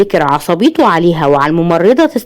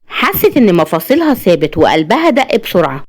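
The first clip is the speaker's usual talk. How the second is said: ordinary delivery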